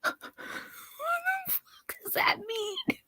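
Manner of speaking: breathless whisper through laughter; a whispered laugh at nonsense. Real, not acted. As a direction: squeak it out, cracking up.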